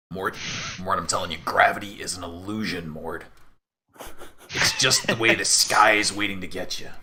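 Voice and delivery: a hint of a doom-warning sidekick; paranoid talking weapon. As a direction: warn him like a mild conspiracy crank.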